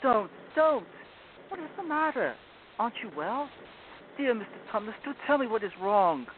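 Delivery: high, pleading and upset; begging him to stop; distressed girl. A narrator's reading. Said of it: "in great distress".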